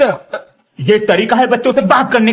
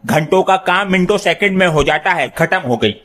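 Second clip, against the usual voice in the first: usual speaking voice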